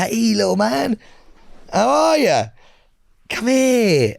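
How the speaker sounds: high, drawn-out cooing; baby-talk a tiny elderly dog; high-pitched pet-greeting voice; coaxing a Chihuahua. Acted. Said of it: "I'd probably be like".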